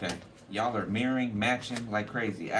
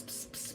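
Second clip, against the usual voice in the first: unvoiced ps ps mocking whispers; whisper imitation; 17 dB quieter than usual. Shown as a demonstration. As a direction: mimic gossip whispering. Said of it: "What's the ps".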